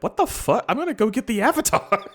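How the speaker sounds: high, loud, fast outburst; mock angry Zuko. Zuko storming off after Iroh's insults, loud and high. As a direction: snap back high and loud. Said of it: "And Zuko's like".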